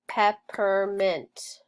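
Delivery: "Peppermint" said slowly, each syllable clear; slow it down for the kids; slow, clear modeling of "Peppermint"; slow careful repeat. Shown as a demonstration. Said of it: "The word is peppermint".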